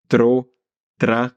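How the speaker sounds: "Tro", "tra" with trilled r; rolled r. Shown as a demonstration. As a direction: roll the r after t. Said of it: "essayer avec d'autres voyelles".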